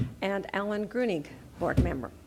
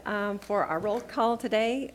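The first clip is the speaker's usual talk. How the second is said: ordinary talk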